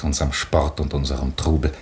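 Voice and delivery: normal talking voice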